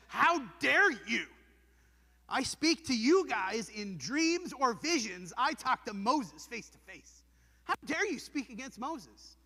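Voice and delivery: God's voice, slow and loud with anger; stern God rebuke. Acted. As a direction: rebuke them as an offended God. God rebukes Miriam and Aaron.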